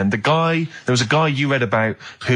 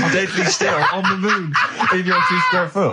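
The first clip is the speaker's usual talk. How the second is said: laughing; 5 semitones higher, slower than usual. Real, not acted.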